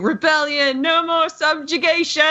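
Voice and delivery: pitched high, a half-hearted rally cry; retold triumphant yell. Co-host retells droid's rally cry, mock triumphant yell. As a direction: echo L3's victory shout with a lighter voice. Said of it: "just screaming".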